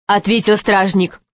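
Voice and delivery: plain reading voice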